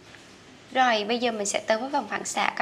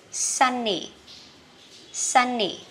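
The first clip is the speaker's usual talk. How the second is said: sunny with SUN up, ny falling; stress on first syllable; 2 semitones higher, much slower than usual. Shown as a demonstration. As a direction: lift "sun", drop "ny" in sunny. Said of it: "mình xanh đi lên còn nì đi xuống".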